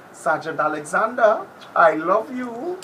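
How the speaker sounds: loud slow declaration of love; shout-out. Real, not acted. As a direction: call it out to him.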